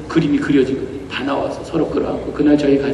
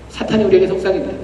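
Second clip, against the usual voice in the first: ordinary delivery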